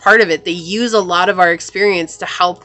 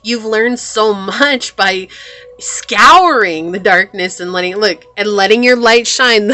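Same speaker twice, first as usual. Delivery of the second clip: ordinary delivery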